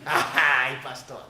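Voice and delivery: mock laughing retort to the pastor; sarcastic laugh. Brother scoffing at pastor's advice, sarcastic laughter. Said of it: "sarcásticamente me reí".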